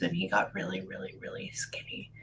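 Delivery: unvoiced hushed whisper. Real, not acted. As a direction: drop to a whisper as the image hits. Medium sensing his illness.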